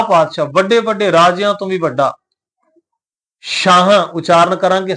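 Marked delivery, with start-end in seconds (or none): 3.4-4.0 ਸ਼ਾਹਾਂ said "shaahaan", with sh; ਸ਼ਾਹਾਂ as "shaa-haan", nasal ending; sh sound. Shown as a demonstration. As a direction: say ਸ਼ਾਹਾਂ with sh and two long aa vowels. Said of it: "ਸੱਸੇ ਪੈਰ ਬਿੰਦੀ ਸਹਿਤ ਉਚਾਰਨ ਕਰਾਂਗੇ".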